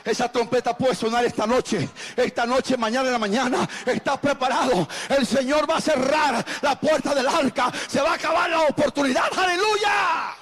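loud, chanted repetitions building to a high shout; full-force preaching. Real, not acted. Pastor warning the ark door will close, full-force preaching. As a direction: drive it up to a climax.

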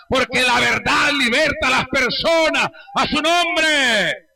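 fervent revival-preacher crescendo; full-force preaching. Real, not acted.

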